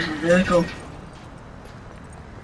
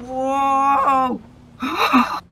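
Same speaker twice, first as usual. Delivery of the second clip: surprised exclamation; 6 semitones higher than usual. Real, not acted. Stunt going wrong in game.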